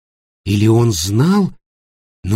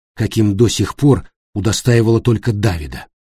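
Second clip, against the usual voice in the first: ordinary delivery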